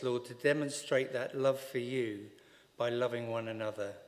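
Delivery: ordinary talk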